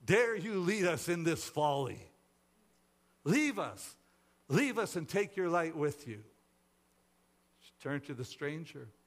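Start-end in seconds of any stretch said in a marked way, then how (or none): 0.0-6.2 loud, angry rebuke; outraged, forceful commands; indignant cry. A narrator's reading. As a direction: drive the person away with raised voice. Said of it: "cried one of the cave dwellers".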